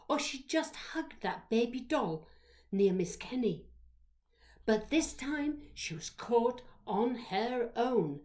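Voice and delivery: usual speaking voice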